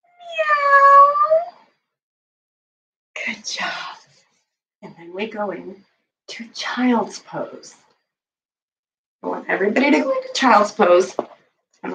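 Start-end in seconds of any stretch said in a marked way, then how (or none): 0.0-1.5 high cat meow. Shown as a demonstration. Teacher leading kids' yoga, a high cat meow. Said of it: "I wanna hear those meows". 3.2-4.0 whisper. Real, not acted. Quiet praise after the meows. 4.8-7.8 calm. Acted. Soothing yoga cue.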